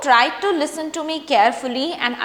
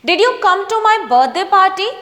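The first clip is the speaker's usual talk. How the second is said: slow, higher and louder question with a rise; slow model reading; 6 semitones higher, 6 dB louder, much slower than usual. Shown as a demonstration. Teacher modelling a question for students to repeat, slow and clear. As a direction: say it slowly and clearly so students can copy. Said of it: "Try to speak".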